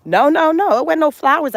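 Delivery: loud playful denial. Real, not acted. Teasing the audience about the door.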